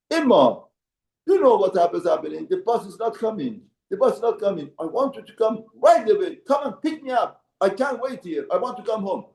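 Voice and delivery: put-on a spoiled kid ordering his mother to come now; demanding child.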